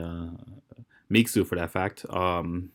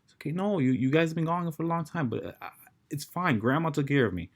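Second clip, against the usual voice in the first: put-on young son consoling; 6 semitones higher than usual. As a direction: gently console a weeping father. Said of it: "the son's trying to console him".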